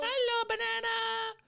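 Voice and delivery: very high, slow drawn-out singsong; cartoonishly high hello; silly banana greeting. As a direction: squeal it out long and goofy.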